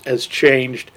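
normal talking voice

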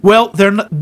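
ordinary delivery

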